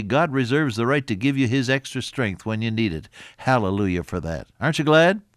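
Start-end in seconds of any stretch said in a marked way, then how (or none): 4.6-5.2 rousing. Real, not acted. Rousing the congregation.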